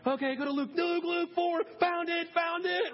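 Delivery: high, excited calling out; mock Bible-drill contestant racing; eager sword-drill kid. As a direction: race to find the verse first, pitched high. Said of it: "the Bible sword game".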